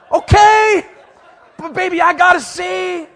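high, loud, slow whining plea; mock protest. Husband protesting to his wife, high loud mock whine. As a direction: playfully beg to look.

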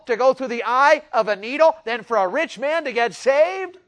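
loud, high revival preaching; fiery preaching. Acted. Preacher firing up the congregation, loud and high. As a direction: preach it out to the pews.

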